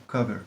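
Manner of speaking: "Cover" softly with the upside-down-v sound; "Cover" as KUH-ver, short uh; correct pronunciation. Shown as a demonstration. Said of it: "A com um chapeuzinho".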